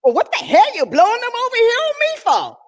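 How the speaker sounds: high, fast, exasperated squawk; fast, high, fed-up retort; cranky psychiatrist. Retold or imitated. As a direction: snap back at the patient, fed up. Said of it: "the psychiatrist looked at it and said".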